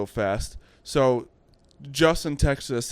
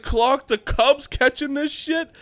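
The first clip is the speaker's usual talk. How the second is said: high, slow, incredulous cry; can't believe the mascot is catching; disbelief; 12 semitones higher, slower than usual. Retold or imitated. To the fellas about the mascot catching, high incredulous disbelief.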